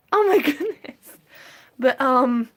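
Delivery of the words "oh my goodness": high, amused exclamation; startled oh my goodness; surprised. Real, not acted. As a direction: caught off guard, voice jumping up. Reacting to the rats.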